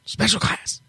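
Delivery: breathy whisper of Special class. Shown as a demonstration. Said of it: "announcer voice".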